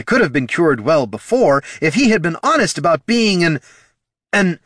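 high, loud, furious rant; heated accusation breaking off; angry growl. Acted. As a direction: rant angrily, then falter. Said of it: "growled the Solomancer".

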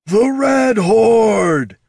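rally the crowd with a huge bellow; bellowed war cry. A narrator's reading. Chieftain Darik bellowing his war cry to the crowd. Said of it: "bellowed Darik Guzvarda".

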